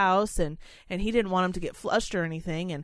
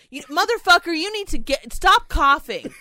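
really fed up, telling him off; real annoyed outburst; 5 semitones higher than usual. Real, not acted. Yelling at her cohost for coughing, real annoyance. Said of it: "I'm getting mad at you for coughing".

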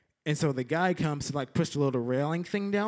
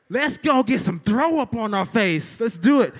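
loud, high, fired-up shout; rally the friends with a hyped-up yell; 7 semitones higher, 7 dB louder than usual. Retold or imitated. Kid to friends before the coaster, hyped shout. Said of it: "I was, I was hyped".

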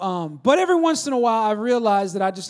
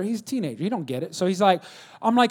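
ordinary talk, nothing marked

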